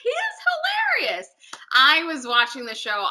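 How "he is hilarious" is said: very high, delighted exclamation; amused delight. Retold or imitated. Replaying her reaction to watching the actor, amused delight. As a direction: squeal it as a replayed rave.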